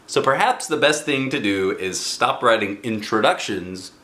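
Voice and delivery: plain reading voice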